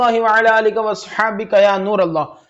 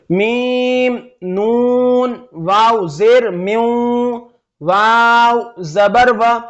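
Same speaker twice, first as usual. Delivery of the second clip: very slow, drawn-out letter by letter; slow spelling out; much slower than usual. Shown as a demonstration. Teacher slowly spelling letters for children. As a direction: stretch each letter name slowly for learners. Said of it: "हिज्जे आपको मैंने बता दिया".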